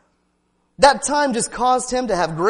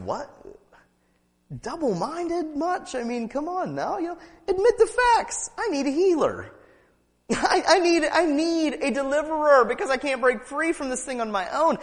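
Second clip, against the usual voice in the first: higher, teasing God voice with a laugh; God's voice; 6 semitones higher than usual. Retold or imitated. Preacher voicing God chiding a doubter, teasing. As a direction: play God gently mocking the doubter. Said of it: "God's like".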